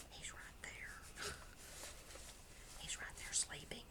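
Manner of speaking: whispering so the sleeping dog stays asleep; hush, the dog is sleeping right there; soft unvoiced whisper beside a sleeping dog. Real, not acted.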